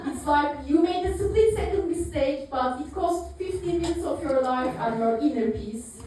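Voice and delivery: usual speaking voice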